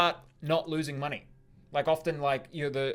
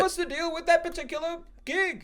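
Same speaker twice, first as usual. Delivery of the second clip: Joel impression. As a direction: play a whiny Joel who likes nothing. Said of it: "No, I was doing a Joel".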